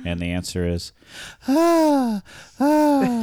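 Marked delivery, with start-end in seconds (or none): 1.5-3.2 high, loud drawn-out ah; suspense tease. Pretend. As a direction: build tension before the reveal. Suspense before answer.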